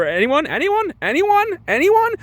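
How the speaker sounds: increasingly high, squeaky appeal; mock pleading. Sarcastic poll about date movie.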